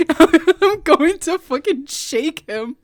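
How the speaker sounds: slow, laughing frustration; loud laughing outburst; laughing exasperation. Real, not acted.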